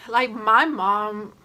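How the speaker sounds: usual speaking voice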